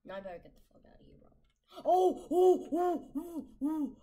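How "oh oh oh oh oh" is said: loud, high startled cries; real panicked shouting at the screen; panicked yelps. Real, not acted. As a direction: yelp in real alarm at a monster. Sudden panic in game.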